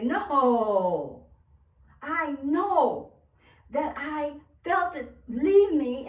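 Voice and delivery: loud, drawn-out refusal; defiant declaration. Acted. Acting out a firm declaration.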